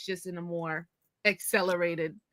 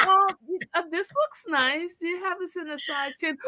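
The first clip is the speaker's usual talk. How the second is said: put-on high-pitched polite kid asking; hesitant young customer; 8 semitones higher than usual. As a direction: imitate a timid shopper, voice lifted high. Retells herself timidly asking the clerk.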